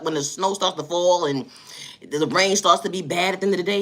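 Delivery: ordinary delivery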